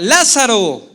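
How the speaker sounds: loud, high, booming command; a ringing order thrown toward the tomb; commanding with authority. Retold or imitated. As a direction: call the dead man out with full authority. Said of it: "con autoridad".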